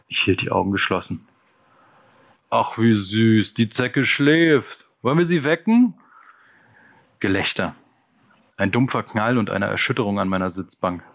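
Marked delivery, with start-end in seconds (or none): 2.5-5.9 slow, drawn-out coo that climbs higher; mock-sweet taunting. Gang member taunts a sleeping punk, mock-sweetly. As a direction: taunt in a sugary singsong.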